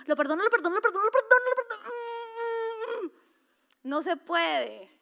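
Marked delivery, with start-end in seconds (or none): 0.1-1.8 pleading climb, pitch rising higher each time into a sob; hammed-up tearful repetition; mock crying forgiveness. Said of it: "lo hiciste mal". 1.8-3.1 imitated whimpering sob. Imitated sobbing after forgiveness.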